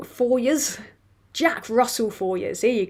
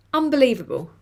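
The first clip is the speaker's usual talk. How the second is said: ordinary talk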